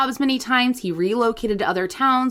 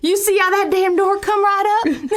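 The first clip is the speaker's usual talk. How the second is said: put-on Southern accent; 9 semitones higher, 3 dB louder than usual. Improvising an alien abduction witness, Southern accent. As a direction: play a rattled Southern witness. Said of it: "If you like our Southern accent".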